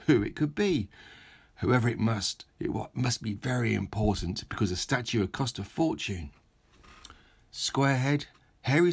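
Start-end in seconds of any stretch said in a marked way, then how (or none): none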